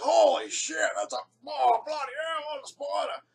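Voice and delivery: high, loud startled outburst; stunned swearing at the spider; shocked. Retold or imitated. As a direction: blurt it out in shock. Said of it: "looks at the spider and goes".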